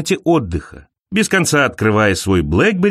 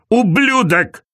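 cried-out curses; 5 semitones higher, much slower than usual. A narrator's reading. Injured mortals swearing at heaven, high-pitched mild outcry. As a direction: spit out two separate curses, pitched up. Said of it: "выкрикивают ругательства".

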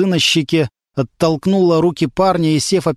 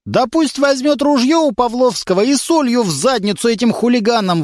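higher put-on old man's rattle; grandfather's rant; 9 semitones higher than usual. A narrator's reading. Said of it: "выдал речитатив старший Верхотуров". (дед выдаёт речитатив, выше и другим голосом)

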